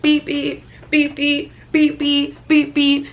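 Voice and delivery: high, loud repeated beep noises; mimicked car beeps. Telling mom about the car locking, loud high mock beeps. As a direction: imitate the car alarm chirping.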